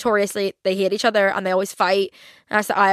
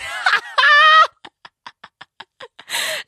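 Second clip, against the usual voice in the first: big shrieking laugh; 6 dB louder than usual. Real, not acted. Vlogger showing viewers an old photo, shrieking with laughter. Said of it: "who is that?".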